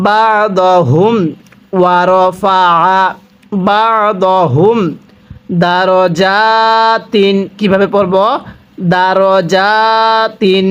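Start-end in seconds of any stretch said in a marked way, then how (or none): 0.1-7.4 very slow, drawn-out Quranic reading; slow recitation. Shown as a demonstration. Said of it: "র জবর র পড়ব". 8.9-10.7 দারজাতিন with a short দা, not দারা, very slowly; correct recitation. Shown as a demonstration. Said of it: "এখানে দারা পড়ব না".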